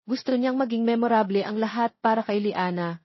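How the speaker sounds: ordinary delivery, nothing marked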